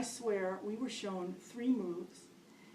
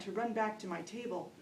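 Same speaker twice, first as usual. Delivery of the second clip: ordinary talk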